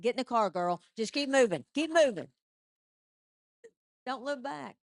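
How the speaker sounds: loud, bossy dog telling her owner to keep going; tough dog voice. Retold or imitated. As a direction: bark the orders like a no-nonsense mama dog. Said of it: "she looked at me like".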